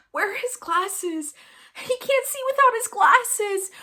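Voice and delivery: high, hammed-up distraught wail; My Girl quote. Quoting My Girl to amuse a coworker, mock-distraught wail. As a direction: cry out high and loud, imitating a movie meltdown. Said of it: "the quote from My Girl".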